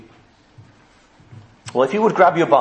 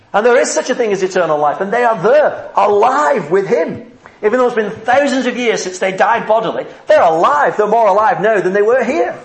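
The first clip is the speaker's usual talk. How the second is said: louder, rising, fervent preaching; preaching at full force; 4 semitones higher, 3 dB louder than usual. Acted. Preacher telling the congregation they're alive, full force. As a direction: drive it home like a preacher.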